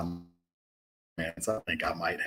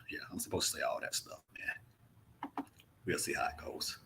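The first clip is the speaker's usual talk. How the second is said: muttered aside; 2 semitones lower, 12 dB quieter than usual. Real, not acted. Grumbling aside about promo duty.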